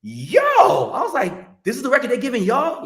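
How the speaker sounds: high, loud shocked yell; excited shout. Retold or imitated. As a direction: blurt it out in disbelief. Shocked at the record choice.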